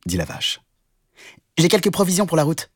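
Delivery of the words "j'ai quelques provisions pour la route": high, quick rabbit voice; small animal piping up fast; little rabbit. Acted. Rabbit offers food for the trip, high and quick. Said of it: "dit le lapin".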